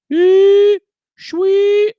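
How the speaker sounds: high, loud squeaky swoosh noise; slider whoosh sound. Pretend. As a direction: mimic the volume slider sliding up. Imitating slider moving.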